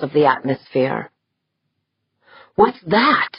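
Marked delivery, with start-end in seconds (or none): 2.6-3.3 sudden high startled cry; yelping in surprise, voice jumping high. A narrator's reading. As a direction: jolt up in alarm at the screen. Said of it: "Corvus yelped".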